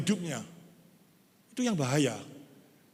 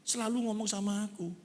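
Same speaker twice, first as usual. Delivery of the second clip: mock tearful thanks; 2 semitones higher, 6 dB quieter than usual.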